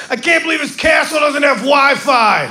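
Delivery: whiny tourist complaint. Retold or imitated. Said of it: "I was like".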